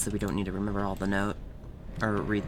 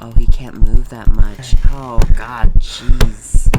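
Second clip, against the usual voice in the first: much louder exasperated exclaims; loud frustrated commentary; loud reaction; 22 dB louder than usual. Real, not acted. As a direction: blurt it out loudly. Reacting loudly to slow character.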